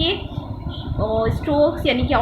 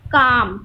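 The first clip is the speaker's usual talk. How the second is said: काम said louder, set apart after a pause; emphatic example word; 5 dB louder, much slower than usual. Shown as a demonstration. Teacher presents the example word to students. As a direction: land hard on काम. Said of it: "यहाँ पे आपका है".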